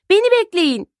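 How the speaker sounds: high call from behind. Acted. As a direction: call after the others. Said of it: "diye sesleniyor". (arkadan seslenme, yüksek)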